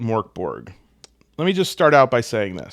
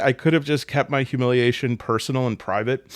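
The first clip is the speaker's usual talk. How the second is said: ordinary talk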